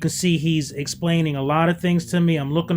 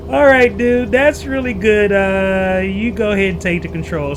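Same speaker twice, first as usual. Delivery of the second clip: higher, louder, keyed-up voice; nervous excited; 3 semitones higher, 5 dB louder than usual. Retold or imitated. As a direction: blurt it out, stomach flipping. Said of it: "So I'm like".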